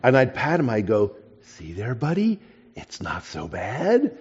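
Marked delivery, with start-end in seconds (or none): 1.4-4.1 very soft, slow, tender reassurance; soothing the lizard. Retold or imitated. Boy stroking his lizard, quiet and slow comfort. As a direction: murmur comfort to a frightened pet.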